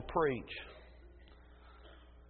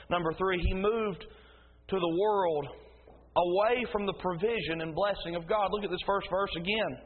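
ordinary delivery

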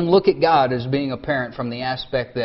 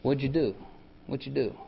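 soft and gentle; soft-spoken God; 5 dB quieter than usual. Pretend. God gently asking Adam to confess, soft and tender. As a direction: ask gently, like a patient father. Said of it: "he comes and he speaks softly".

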